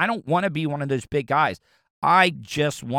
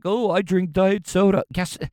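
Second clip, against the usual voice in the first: higher-pitched mock self-satisfied voice; mimicking people; 5 semitones higher than usual. Host imitates people who drink diet soda, mocking higher voice. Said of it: "people are all like".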